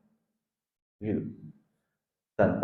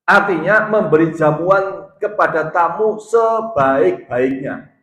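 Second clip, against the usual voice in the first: slow, raised, high sermon voice; emphatic preaching; 5 semitones higher, 7 dB louder, slower than usual. Acted. Preacher teaching the duties of host and guest, emphatic preaching. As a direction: lay down each clause slowly and forcefully.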